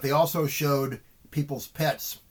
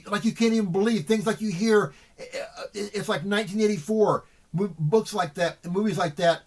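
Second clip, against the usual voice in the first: agitated; 5 semitones higher, 4 dB louder than usual. Real, not acted. Distressed rant about the lockdown videos.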